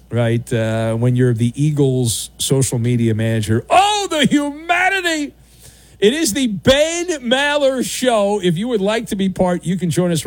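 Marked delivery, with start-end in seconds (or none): 3.7-5.3 higher, hammed-up wail of despair; mock tragic lament. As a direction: cry out like a disaster announcer.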